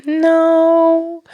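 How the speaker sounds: high, held-out "no"; mock reluctant refusal. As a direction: imitate her awkwardly stretching the refusal. Woman refuses another date.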